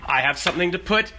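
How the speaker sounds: high, loud, rushing with delight; giddy excitement. Real, not acted. Excited rice cake discovery.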